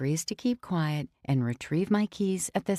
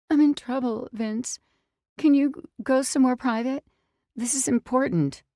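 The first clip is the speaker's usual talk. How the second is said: higher and louder, as if near tears; acting tearful; 7 semitones higher, 5 dB louder than usual. Girl fakes tears to get Vince's help, pleading. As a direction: plead as though about to cry. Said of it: "I start to cry".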